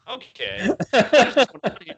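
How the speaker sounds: retold disbelief. Watching bad referee calls, retelling his baffled outburst. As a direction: builds from a doubtful okay to a loud, baffled outburst. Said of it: "I was like".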